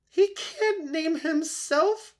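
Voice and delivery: very high, loud, slow goose squawk; Loudwing. A narrator's reading. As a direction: squawk it in exasperation, stretching each word. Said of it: "squawked Loudwing".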